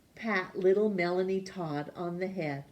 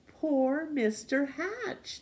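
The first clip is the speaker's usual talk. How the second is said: pitying; 8 semitones higher, slower than usual. A narrator's reading. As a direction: draw it out with sympathy. Children pitying Mister Hatch.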